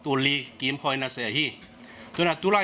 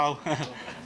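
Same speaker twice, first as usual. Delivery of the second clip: brief, softer laugh; soft chuckle; 6 dB quieter than usual. Real, not acted. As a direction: let a small real chuckle slip out. Laughter dying down after aside.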